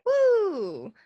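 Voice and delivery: high, loud whoop of joy; excited cheer. Real, not acted. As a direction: cheer like your favorite segment just started. Cheering intro of AMV segment.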